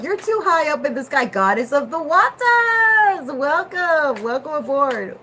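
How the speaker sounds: high, loud, slow proclamation; grand announcer. Pretend. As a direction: welcome them like a herald. Mock grand welcome.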